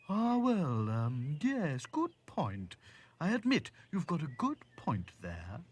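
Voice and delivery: hesitant creature voice starting high; conceding giraffe. Acted.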